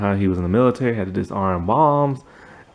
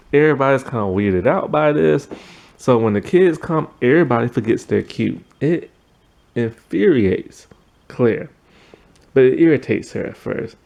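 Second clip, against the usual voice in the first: normal talking voice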